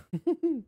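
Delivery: a quick high-pitched laugh; short high laugh. Real, not acted. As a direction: burst into a little laugh. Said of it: "I made myself laugh".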